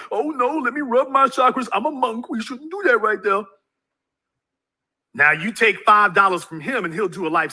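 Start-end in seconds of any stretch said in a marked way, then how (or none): 0.1-3.4 mock monk. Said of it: "start talking about".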